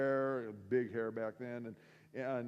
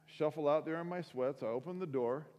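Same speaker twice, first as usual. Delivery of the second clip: ordinary delivery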